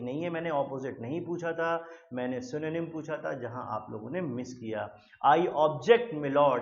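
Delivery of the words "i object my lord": loud movie-lawyer objection; hammed-up film courtroom cry. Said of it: "ऐसा आपने movie में कई बार सुना होगा".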